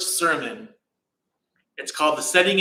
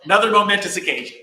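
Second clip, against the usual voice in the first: quick, louder with a laugh; laughing aside; 5 semitones higher, 5 dB louder, faster than usual. Real, not acted. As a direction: toss it off laughing. Laughs at forgotten detail.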